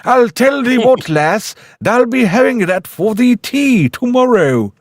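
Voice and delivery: hammed-up northern patriarch from a film; James Mason. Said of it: "James Mason in Spring and Port Wine".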